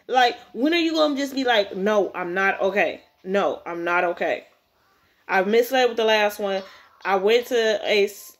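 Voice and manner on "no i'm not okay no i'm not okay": low-pitched, firm mock answer; low matter-of-fact refusal to pretend; deadpan admission. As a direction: say it bluntly, as the boy should. Reader acts answer she wants said.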